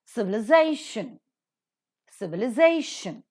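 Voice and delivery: Civilization accented on ZA, said twice; stress before shun. Shown as a demonstration. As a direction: model civili-ZA-tion with a pause between repeats. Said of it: "these words that are pronounced".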